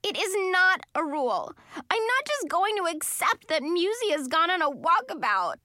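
tearful, high and loud protest; voice breaking. A narrator's reading. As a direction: hold back tears while insisting. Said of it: "my hoarse voice breaking".

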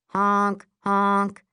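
two loud, even horn blasts; imitated car honks. Street traffic intruding on the moment, honking. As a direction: honk twice like a car. Car horns interrupt the scene.

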